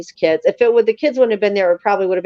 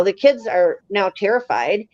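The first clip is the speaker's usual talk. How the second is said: ordinary delivery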